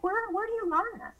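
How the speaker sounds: high-pitched disbelieving question; amazed. Retold or imitated. Mother marveling at her handy son, high and amazed. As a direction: marvel at the kid's handiwork, voice leaping up. Amazed at son's fixing skills.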